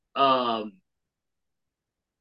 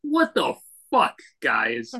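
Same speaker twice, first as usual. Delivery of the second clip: incredulous; slower than usual. Real, not acted. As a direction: pitch it up in shock. Outraged at price-fixing bread.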